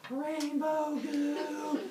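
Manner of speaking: very high, stretched-out squeal of wonder; overjoyed humans, slow and high; excited. Acted. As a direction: draw each word out in hammed-up awe. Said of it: "they get all excited".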